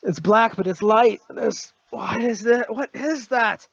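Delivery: high-pitched, held-back yelling; high, halting acted outcry; mock yelling. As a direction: act out a man crying out at what he sees.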